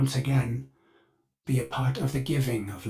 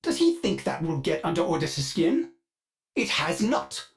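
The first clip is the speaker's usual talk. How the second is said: ordinary delivery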